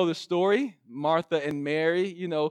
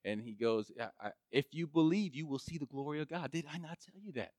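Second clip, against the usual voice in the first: soft Jesus; 7 semitones lower, 12 dB quieter than usual. Retold or imitated. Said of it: "And Jesus says".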